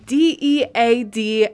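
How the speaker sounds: each letter D E A D hit separately; spelled out. Retold or imitated. Friend spelling the word out to hurt her, letter by letter.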